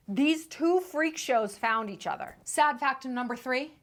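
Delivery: usual speaking voice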